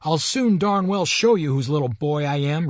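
put-on a child trying to sound fierce; ferocious boy. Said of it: "in a ferocious voice".

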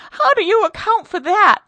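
high, loud shriek of a question; terrified cry. Acted. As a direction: point and shriek. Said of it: "she cried".

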